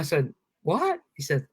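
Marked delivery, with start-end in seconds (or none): 0.6-1.0 high-pitched, rising disbelief; retold startled question, pitched way up; taken aback, voice jumping high; surprised.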